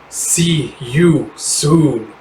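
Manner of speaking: very slow taunting farewell; slow sinister promise. Acted. The Zimmy taunting Forever as she flees, very slow. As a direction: draw out a threat. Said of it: "the Zimmy going".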